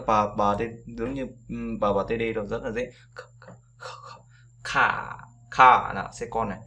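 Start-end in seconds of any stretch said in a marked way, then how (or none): 3.1-4.2 "K" rất nhỏ, chỉ có hơi; thì thầm "k" thật nhẹ. Shown as a demonstration. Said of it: "Giống như cái âm nhẹ thôi". (âm "k" nhẹ, chỉ có hơi)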